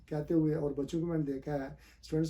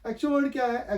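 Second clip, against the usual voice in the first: ordinary talk, nothing marked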